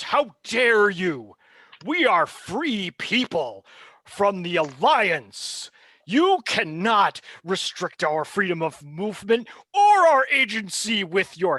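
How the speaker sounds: high, loud, slow indignant protest; indignant outrage. Acted. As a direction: defy him with outrage. Monica defies the villain.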